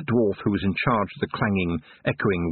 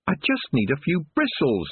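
desperately; 7 semitones higher than usual. Acted. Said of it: "said Tiffany desperately".

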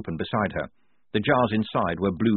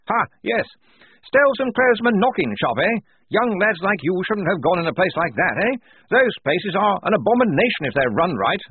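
high, loud hearty officer voice; blustering major; 10 semitones higher, 6 dB louder than usual. Acted. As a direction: boom out like a jolly old officer.

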